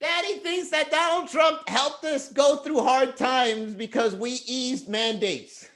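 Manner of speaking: put-on high, slow giggling kid voice; mocking child. Child mocking daddy's Trump post, high and giggly.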